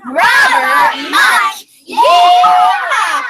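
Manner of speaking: put-on rally the kids with a big cheer; cheering shout. Shown as a demonstration. Teacher leading kids in a loud cheer. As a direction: shout the name out long and high. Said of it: "say with me".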